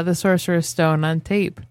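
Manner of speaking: ordinary talk, nothing marked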